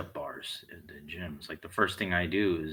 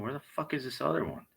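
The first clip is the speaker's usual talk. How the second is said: fast irritated mutter, higher; quick annoyed mutter; 6 semitones higher, faster than usual. Retold or imitated. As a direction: rattle it off under your breath, annoyed. Searching the gym, muttering.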